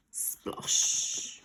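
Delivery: a hushed, breathy splosh; whispered splash. A narrator's reading. Splash sound effect.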